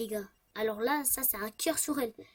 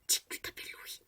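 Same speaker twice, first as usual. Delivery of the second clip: breathy unvoiced prompt; whisper; 9 dB quieter than usual. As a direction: slip him the answer under your breath.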